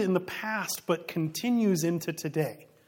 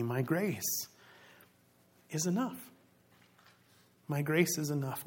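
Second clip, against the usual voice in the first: tender reassurance; 6 dB quieter than usual. Acted. God reassuring Paul about his thorn, soft and tender. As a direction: gently assure him grace is enough.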